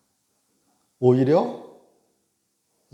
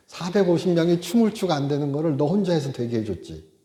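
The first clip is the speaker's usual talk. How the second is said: loud, higher exasperated scolding; mock rebuke; 5 semitones higher, 4 dB louder than usual. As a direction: list his favours like an offended God. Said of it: "이러실 수도 있잖아요". (서운한 하나님처럼 크게 꾸짖는 말투)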